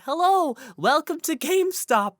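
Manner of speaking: high, bright clerk greeting, slowed down; mock shop clerk. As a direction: play the chirpy GameStop clerk welcoming you.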